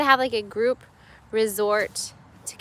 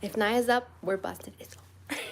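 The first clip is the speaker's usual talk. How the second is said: whispering; 10 dB quieter than usual. Real, not acted. Whispering near sleeping baby.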